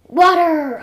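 loud, high cry of delight; excited yell. A narrator's reading. Jeff feels water splash in the desert, excited yell. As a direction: shout it out in joyful discovery. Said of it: "I yelled excitedly".

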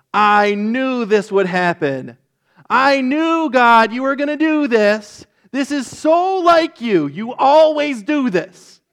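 loud, high, whiny complaint at God; a prophet furious that God forgives; mad Jonah. Retold or imitated. Jonah loudly complaining to God about His mercy, high and whiny. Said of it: "Like he's mad".

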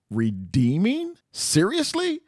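incredulous. Real, not acted. Incredulous echo of the word.

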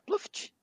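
high squeaky plop noise; fart sound. Pretend. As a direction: blurt out a silly fart sound. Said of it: "fazer o barulhinho dela".

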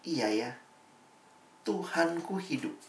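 a soft, amazed aside; quiet realization. Retold or imitated. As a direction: murmur it like it just sank in. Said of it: "akhirnya melihat".

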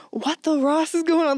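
imitated Jamaican accent, pitched high; thick Jamaican accent. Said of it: "like, the thickest Jamaican accent".

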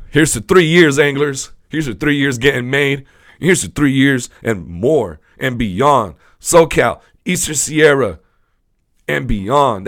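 loud, high, proud cheer to the audience; host toasting his anglers, loud and proud; rousing toast. Real, not acted. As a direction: raise a glass and shout it out. Toasting three channel years.

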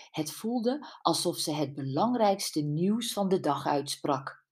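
ordinary delivery, nothing marked

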